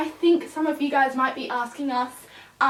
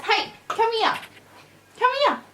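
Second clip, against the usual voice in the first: much higher coaxing call; calling the puppy; 10 semitones higher, 4 dB louder than usual. Real, not acted. Owner calling wandering puppy, high coaxing call. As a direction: lure him back.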